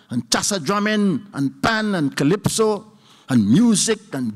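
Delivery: slow, deliberate listing of each art; very slowly. Real, not acted. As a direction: spell it out slowly so it sinks in. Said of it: "I'm saying this very slowly".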